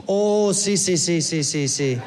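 put-on rapid-fire agreeing in a Costa Rican accent. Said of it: "He goes".

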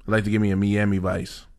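put-on Spanish-accented Miami. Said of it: "do you have to say it with, like, an accent?".

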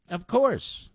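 wry muttered echo; muttered sarcastic echo. Real, not acted. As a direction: repeat it under your breath, knowingly. Echoes own aside under breath.

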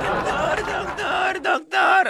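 high calls building to a shout; frantic calling. Retold or imitated. Mother rushing in calling the doctor, frantic shout.

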